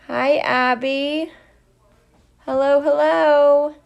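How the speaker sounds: ordinary talk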